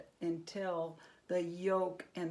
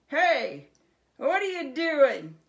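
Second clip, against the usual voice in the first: much louder, higher goblin challenge; indignant goblin; 7 semitones higher, 12 dB louder than usual. A narrator's reading. Tiny goblin catching Herschel lighting candles, loud and high. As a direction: catch the intruder.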